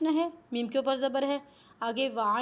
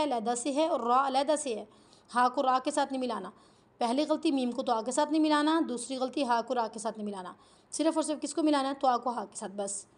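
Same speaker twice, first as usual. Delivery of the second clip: ordinary delivery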